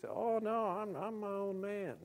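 high mocking whine. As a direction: mimic a smug self-made man. Mocking a self-reliant person.

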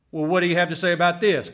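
loud, fast challenge; pressing challenge. Acted. Preacher voicing a doubter questioning Christ, pressing. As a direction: fire the question loudly and quickly.

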